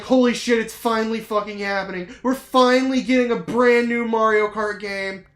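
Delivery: loud, high excited shout; yelling with joy that it's finally happening. Retold or imitated. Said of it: "I was like".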